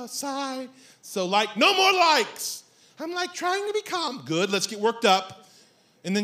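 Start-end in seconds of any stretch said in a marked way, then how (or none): 0.1-0.7 soft, breathed stage sigh; sigh, then say the word softly; mock weary exhale; put-on sigh. Acting out the woman's sigh. 1.6-2.6 high, drawn-out outburst; hammed-up annoyance; exasperated protest. Protesting the woman's filler word. 3.0-4.2 high, soft girlish imitation; airy put-on woman's voice; dating-show woman.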